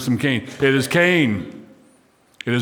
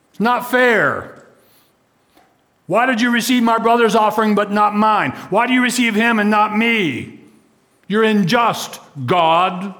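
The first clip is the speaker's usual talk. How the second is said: Cain's complaint; 7 semitones higher than usual. Retold or imitated. Cain accusing God of injustice, higher aggrieved voice. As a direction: whine your grievance at God like Cain.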